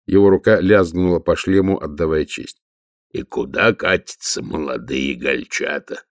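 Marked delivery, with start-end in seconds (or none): 3.1-6.0 low, slow troll rumble; lightly voiced lumbering troll. Troll Detritus speaks.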